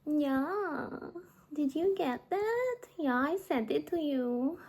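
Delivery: put-on high, drawn-out Delhi accent; friend's Delhi accent. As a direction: imitate a Delhi girlfriend, slow and sing-song. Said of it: "like, Delhi accent".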